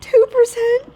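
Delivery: high, loud alarmed question; surprised. Real, not acted. She hears her friend's phone is nearly dead, loud surprised question. Shocked at friend's low battery.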